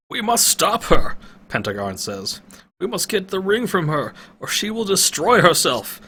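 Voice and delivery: rally the party; old man urging the party on; urgent old wizard. Acted. Pentagon voiced, urgent.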